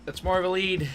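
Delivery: ordinary talk, nothing marked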